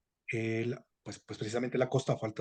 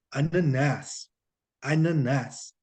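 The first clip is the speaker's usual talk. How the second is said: "ananás" drawn out slowly in Brazilian Portuguese; Brazilian singsong; much slower than usual. Shown as a demonstration. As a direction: Brazilian lilt, stressed last vowel of "ananás". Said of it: "ese cantadito que tienen los". (cantadito brasileño al decir "ananás")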